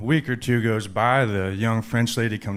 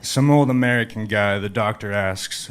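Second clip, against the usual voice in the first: usual speaking voice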